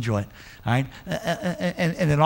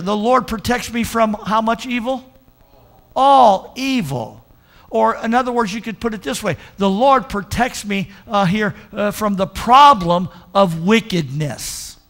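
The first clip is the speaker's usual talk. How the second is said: higher, slow and louder on All evil; intense preaching; 4 semitones higher, 3 dB louder than usual. Acted. Pastor preaching scripture to the church, higher and urgent. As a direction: drive each phrase home.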